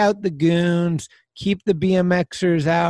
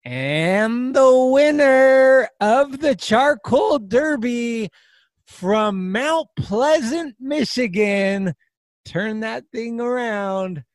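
drawn-out rising build to a shouted name; winner announcement; 8 semitones higher, slower than usual. Acted. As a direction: hype the winner reveal slowly.

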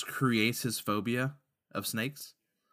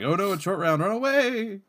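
high squealing panic; panicked snakes; 11 semitones higher, 6 dB louder than usual. Pretend. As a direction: shriek it like fleeing snakes. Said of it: "Snakes are just like".

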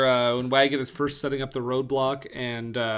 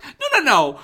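higher, louder, rushing protest against the movie trope; mock exasperated rant; 4 semitones higher, 6 dB louder, faster than usual. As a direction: lecture the screen in comic frustration. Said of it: "I'm like".

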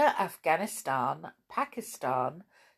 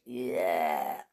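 deep, growly yuck of disgust; 12 semitones lower than usual. Acted. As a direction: groan it out in disgust. Reacting to the dirty trench.

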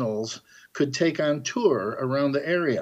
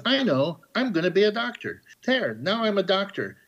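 lightly voiced, higher-pitched naive youth; a hint of a naive would-be doctor, pitched up; naive young man; 6 semitones higher than usual. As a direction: play the clueless young man lightly, voice lifted. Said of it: "there is a naive young man".

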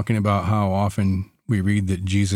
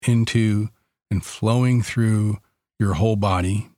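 guided meditation. Acted.

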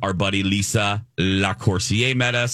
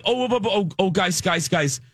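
flustered halting; 8 semitones higher than usual. Retold or imitated. Retelling how he halted excited women.

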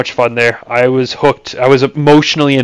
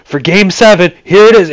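excited radio call; 8 semitones higher, 6 dB louder than usual. Retold or imitated. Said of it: "He's like".

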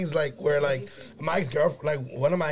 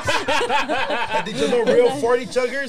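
laughing; 8 semitones higher, 5 dB louder, much slower than usual. Real, not acted. Laughing while teasing friends.

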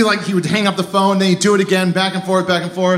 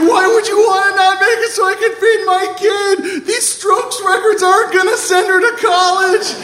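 high, wailing tearful shout; sob-yell it, hammed up; acted hysterical heartbreak; crying boss; 13 semitones higher than usual. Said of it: "my boss starts to cry".

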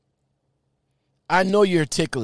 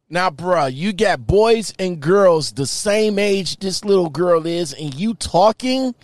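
loud, furious confronting rant; angry scolding; 3 semitones higher, 4 dB louder than usual. Real, not acted. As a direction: call him out loudly, disgusted. Confronting the man in the chat.

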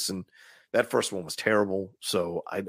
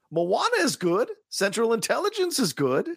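statements ending on a rising tune, pitch higher; uptalk; 11 semitones higher than usual. Real, not acted. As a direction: say it like a question. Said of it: "when your voice goes up at the end".

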